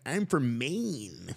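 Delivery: drawn-out, attempted Maine accent. Imitating the professor from Maine, badly attempted Maine accent. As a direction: stretch the words in an exaggerated Maine accent. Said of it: "I can't even do the accent".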